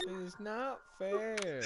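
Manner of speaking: slow, high mock Jamaican whine. Friend mocking gamer's complaint, slow mock whine. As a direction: parrot his complaint mockingly.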